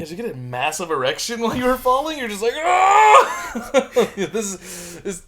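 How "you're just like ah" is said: high, loud shouted aah; mock scream. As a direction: yell out in pretend pain. Mocking scream while falling.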